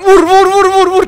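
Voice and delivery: high, loud panicked yelling; panicked shout. Real, not acted. Gamer yelling at himself to hit the attacker in a loud panicked scream. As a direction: scream it while fighting for your life.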